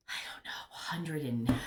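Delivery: whispered aside while counting. Real, not acted. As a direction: breathe the words out with no voice. Counting plants under her breath.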